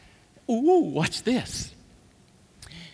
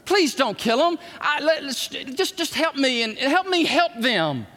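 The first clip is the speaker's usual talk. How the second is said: pleading Moses; 7 semitones higher than usual. Retold or imitated. Moses begging God not to destroy the people, frantic higher pleading. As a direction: beg God desperately to spare them. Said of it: "And Moses says".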